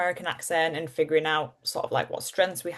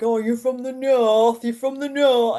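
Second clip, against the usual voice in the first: mock Londoners; 5 semitones higher, 4 dB louder, slower than usual. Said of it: "people were like".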